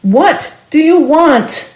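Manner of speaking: high, loud, slow demanding shout. A narrator's reading. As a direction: yell it into the air.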